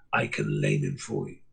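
deep, otherworldly dog voice; devil dog voice. Acted. Devil dog tempting Alison, deep and eerie. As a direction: speak low like a demon hound.

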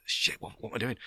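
unvoiced panicked aside; whisper. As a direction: whisper it to yourself in dread. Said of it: "Just typed yes".

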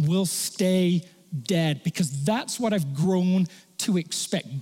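normal talking voice

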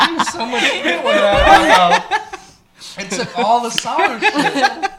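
laughing. Real, not acted. Sour beer reaction, laughing.

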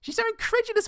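squeaky high sarcastic repeat; mocking high echo. Co-host echoes the sarcastic remark.

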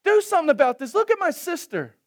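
high, loud acted complaint; hammed-up exasperated appeal; fed-up Martha. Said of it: "And so she speaks up".

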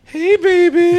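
high, loud sweet-talking; flirty phone voice.

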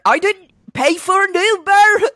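put-on high-pitched, botched Irish accent; bad Irish accent. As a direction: attempt an Irish accent and miss badly. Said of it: "I can't do an Irish accent".

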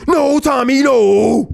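high, drawn-out pleading holler; hammed-up movie "no" with stretched vowels; imitated movie cry. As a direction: mimic someone crying out to stop a fighter. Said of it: "You remember when Tommy dug his feet".

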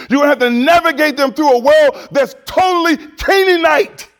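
full-force sermon build; fiery preaching. Acted. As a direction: preach it to the whole church.

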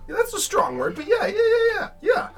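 nervous squeaky voice. Acted. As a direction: babble yes nervously to please. Same character keeps squeaky voice.

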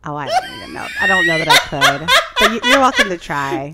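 real laughter breaking through a loud protest; bursting out laughing while begging off; laughing refusal. Real, not acted. Declining accent request, laughing.

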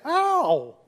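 sudden high yelp of pain; sharp pained cry. Retold or imitated. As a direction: yelp as the sore wrist is pressed. Nurse presses wrist again.

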